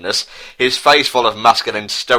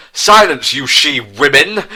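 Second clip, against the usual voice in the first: louder, higher furious shout; angry yell; 5 semitones higher, 4 dB louder than usual. A narrator's reading. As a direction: snap at the captives to shut up. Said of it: "yelled Prince Harry in anger".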